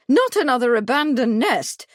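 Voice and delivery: loud, high alarmed cry; cried violently. A narrator's reading. Miss Truebody cries out in alarm at the news. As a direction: burst out in alarm. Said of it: "cried violently".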